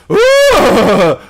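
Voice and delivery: imitating a contestant's hooting; mock elephant noise. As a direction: hoot it like a clumsy elephant. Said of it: "she goes".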